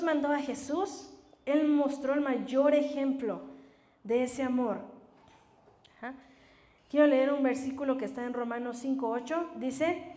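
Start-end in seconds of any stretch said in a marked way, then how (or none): none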